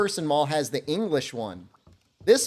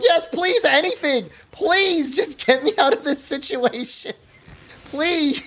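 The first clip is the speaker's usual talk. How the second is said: much higher, louder begging to escape; desperate pleading; 7 semitones higher, 4 dB louder than usual. Retold or imitated. Begging the saleslady to end it, high desperate plea. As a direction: grab any way out. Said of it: "I'm like".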